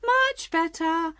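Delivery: slow, high, stretched squeal. A narrator's reading. Queen getting carried away as she answers, squealing. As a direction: draw it out in a high voice. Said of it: "her voice getting higher and higher".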